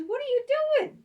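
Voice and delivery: very high, loud startled cry; shocked boyfriend. Retold or imitated. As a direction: yelp it in disbelief. Boyfriend shocked she's in bed.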